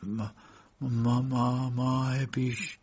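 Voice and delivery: stammering, halting start; flustered man searching for words; hesitant stammer. A narrator's reading. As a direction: stumble over the first syllable. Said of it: "مرد کمی من و من کرد".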